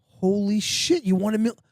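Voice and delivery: high, stunned disbelief; high playacted holy-shit surprise; mock shock. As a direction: fake being floored by the win. Retold faked shock.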